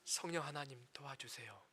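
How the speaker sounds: soft, low plea for help; quiet prayer. Shown as a demonstration. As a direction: pray it quietly, like a plea under the breath. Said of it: "어떻게 하라고요". (낮고 조용하게 간절히 드리는 기도)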